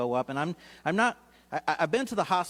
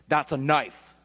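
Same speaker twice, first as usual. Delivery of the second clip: flat, louder warning like a security guard; security guard flags the knife, curt and louder; blunt guard; 5 dB louder, slower than usual. Retold or imitated. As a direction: stop him cold at the scanner. Security guard stops the speaker.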